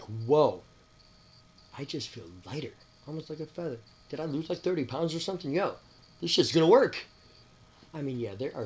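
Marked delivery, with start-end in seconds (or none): 0.0-7.1 rising to a loud excited yo; amazed relief. Retold or imitated. His first day feeling lighter, wonder rising to loud excitement. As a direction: build from wonder to a loud cheer. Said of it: "the first day I'm like".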